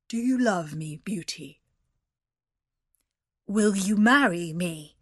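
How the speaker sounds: gruff beast proposing. A narrator's reading. Beast asking Beauty to marry him, gruff. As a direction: ask it as a gruff beast, weightily. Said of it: "he said in a gruff voice".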